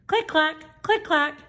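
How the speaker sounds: high, crisp click-clack; bright mock hammer taps; tapping sound. A narrator's reading. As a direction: tap it out like a tiny hammer. Shoemaker's hammer sound imitated.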